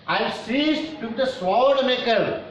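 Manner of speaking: high, slow declaimed verse; poem recitation. Real, not acted. Teacher reads poem's line to students, high slow declamation.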